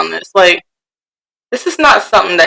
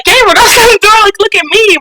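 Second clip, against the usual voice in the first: scolding mom; 12 semitones higher than usual. Retold or imitated. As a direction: play the exasperated parent. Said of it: "'cause I'm like".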